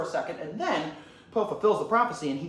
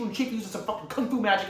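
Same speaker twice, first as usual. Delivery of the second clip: normal talking voice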